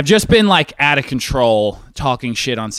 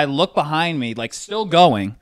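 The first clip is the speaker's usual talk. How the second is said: ordinary talk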